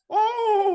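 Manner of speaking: high, loud gasp breaking into a laugh; a sudden sting, yelp then laugh; comic high yelp of surprise; shocked laughing cry. Retold or imitated. Rag soaked in mineral spirits.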